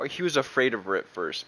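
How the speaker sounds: normal talking voice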